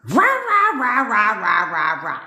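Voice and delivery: loud mocking roar, starting high and dropping; mock angry ranting. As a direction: imitate a husband yelling as noisy gibberish. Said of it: "let's say you have an argument and he's fucking".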